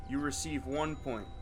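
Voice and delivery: usual speaking voice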